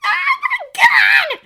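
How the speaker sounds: halting start, then drawn-out piercing squeal. A narrator's reading. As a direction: stammer building to a loud high shriek. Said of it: "his protege squealed".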